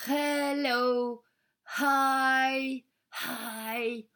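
"hello" and "hi" with a huge blown H; camel breathing. Acted. Kids blowing out their H like camels, laughing. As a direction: blow the H out like a camel. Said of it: "On a bien rigolé à faire le chameau".

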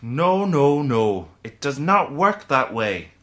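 loud, fed-up correction; an irritated no, no, no; exasperated. Acted. The leprechaun, fed up with Anna's wish, answers loudly. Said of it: "du même air exaspéré, et soupira de nouveau".

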